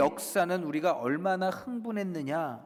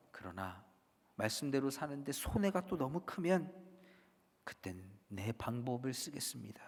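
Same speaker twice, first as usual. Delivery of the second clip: soft, lowered private reservation; quiet backtracking; 3 semitones lower, 10 dB quieter than usual. Acted. Believer hedging the vow just made, quiet backtracking. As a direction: slip the condition in under your breath.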